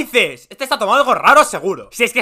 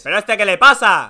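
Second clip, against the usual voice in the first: ordinary talk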